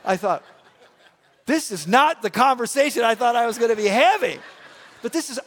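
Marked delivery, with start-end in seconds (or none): 1.5-5.4 loud, higher, laughing through the words; laughing disbelief. Real, not acted. Pastor telling his congregation the story, laughing. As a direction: laugh at the absurdity.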